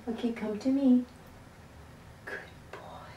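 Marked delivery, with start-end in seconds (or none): 2.3-3.1 soft whisper to a pet; whispered praise. Real, not acted. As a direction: whisper it gently.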